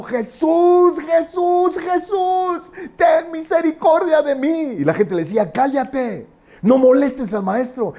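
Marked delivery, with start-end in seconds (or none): 0.0-4.8 high, long-drawn beggar's cry; desperate shouting. Acted. Blind Bartimeo calling after the Lord, high desperate shouting. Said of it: "venía Bartimeo por atrás y gritaba". 5.5-7.9 high-pitched crowd rebuke; scolding. Acted. Crowd telling the blind man to hush, high scolding. Crowd silencing the shouting beggar.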